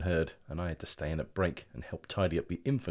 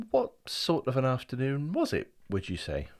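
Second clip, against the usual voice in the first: cautious; 6 semitones higher than usual. Acted. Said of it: "I said, cautiously".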